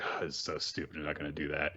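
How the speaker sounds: low, soft dismissive grumble; muttered dismissal. Retold or imitated. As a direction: brush it off under your breath. Ren dismisses the scheme.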